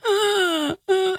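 hammed-up sobbing; fake crying. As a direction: pretend wail to the cop.

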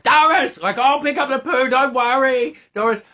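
sustained high-pitched calling. Retold or imitated. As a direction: holler it high to a deaf friend in the distance. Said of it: "I'm trying to yell to her".

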